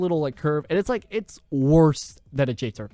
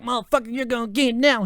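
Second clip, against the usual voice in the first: angry threat; 8 semitones higher than usual. Retold or imitated. Burnt coworker threatening the narrator, imitated angry threat. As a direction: snarl it like a man chasing you down. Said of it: "he was like".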